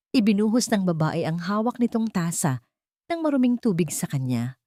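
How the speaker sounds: plain reading voice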